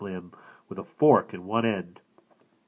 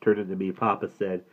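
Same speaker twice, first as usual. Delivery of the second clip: usual speaking voice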